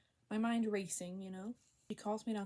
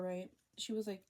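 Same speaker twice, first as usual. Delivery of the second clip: usual speaking voice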